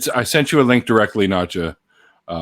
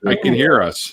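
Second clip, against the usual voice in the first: ordinary talk, nothing marked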